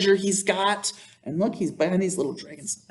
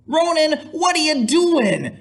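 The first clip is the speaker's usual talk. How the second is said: calling friend; 12 semitones higher than usual. Acted. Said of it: "asked Gunnar".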